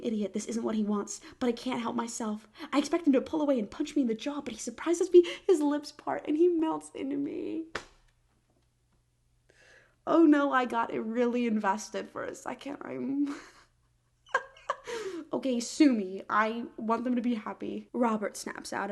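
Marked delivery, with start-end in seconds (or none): 2.7-7.6 fast, rising romance read; breathless build. Acted. Climax of the kiss scene. 13.4-16.2 laughing. Real, not acted. Laughing at own investment.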